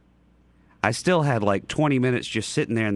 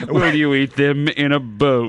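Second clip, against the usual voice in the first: put-on reading to a toddler; 4 dB louder, slower than usual. Dr. Seuss read to kids.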